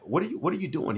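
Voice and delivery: high-pitched puzzled questioning; stammered, high question to a son; baffled father. Retold or imitated. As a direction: retell a dad's startled confusion. Father questioning crying son, retold.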